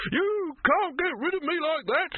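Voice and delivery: very high, indignant squawk; indignant protest. A narrator's reading. Silverfish, held by the collar, defies Dibbler, indignant. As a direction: protest slowly at a strangled high pitch.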